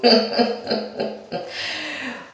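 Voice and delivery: long, high giggle; giggling. Real, not acted. Host admits to viewers she has no prize, giggling. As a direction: laugh lightly at your own joke.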